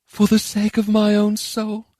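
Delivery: high, slow, wistful smile; gentle wistfulness in a high character voice. A narrator's reading. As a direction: answer high and slowly, with longing. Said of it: "Charon answered with a wistful smile".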